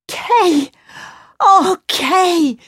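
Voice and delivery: high, emotional call; crying out. A narrator's reading. Girl calls to her long-lost friend, crying out. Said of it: "cried the voice".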